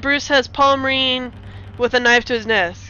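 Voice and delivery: usual speaking voice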